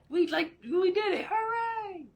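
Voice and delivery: high excited cheer. Real, not acted. Streamer celebrating the completed request, high cheer. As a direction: squeal of joy, voice up high.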